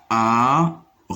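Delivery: "Arrosoir" stretched slowly, a-rro, pause, soir; syllable by syllable. Shown as a demonstration. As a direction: draw out and separate the beats of "Arrosoir". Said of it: "troisième syllabe". (« Arrosoir » étiré : a… rro… soir)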